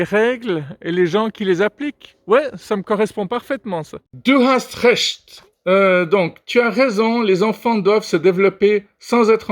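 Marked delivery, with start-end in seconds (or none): 4.1-5.4 slow, higher-pitched German; speaking German. Acted. Answering in German, very slow and emphatic. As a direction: stretch out each German word with emphasis. Un peu d'allemand maintenant.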